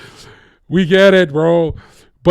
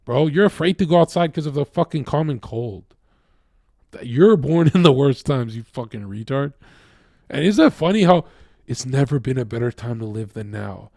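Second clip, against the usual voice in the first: ordinary talk, nothing marked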